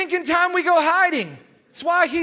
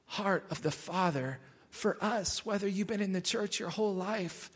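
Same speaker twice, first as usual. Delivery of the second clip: hushed reflection; 9 semitones lower, 13 dB quieter than usual. Real, not acted. Sermon drops to hushed reflection.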